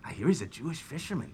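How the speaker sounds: hushed, quick gossip among guests; gossiping whisper. Acted. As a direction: lean in and murmur it. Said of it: "they're probably all whispering".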